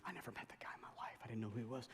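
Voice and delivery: hushed whisper, leaning in; whispered aside. Real, not acted. Pastor whispering to the congregation mid-story, hushed. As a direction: whisper it like a secret.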